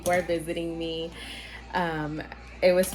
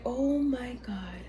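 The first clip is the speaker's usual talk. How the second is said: retold dismay; 4 semitones higher, 7 dB quieter, much slower than usual. Retelling her shock at the shaking.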